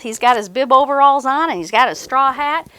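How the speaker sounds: ordinary delivery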